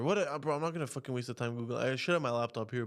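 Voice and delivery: normal talking voice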